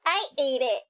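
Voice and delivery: usual speaking voice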